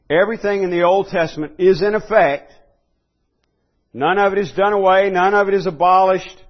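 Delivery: loud pulpit declaration; ringing proclamation. Acted. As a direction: declare it with full conviction. Preacher declaring emphatically.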